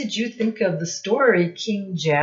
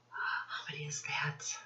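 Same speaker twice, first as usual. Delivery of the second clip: quick whisper to herself; hushed counting under her breath; whispered aside; 15 dB quieter than usual. Real, not acted. Aside while counting feet.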